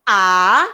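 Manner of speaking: A swept upward; second tone. Shown as a demonstration. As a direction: let A go upward forcefully. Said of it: "Dia naik ke atas".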